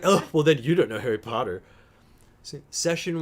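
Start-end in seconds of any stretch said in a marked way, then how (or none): 0.0-1.6 louder, smug put-down; mock dismissive. Imitating V's smug dismissal about Harry Potter. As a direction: toss it off like the final verdict. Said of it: "And you're like".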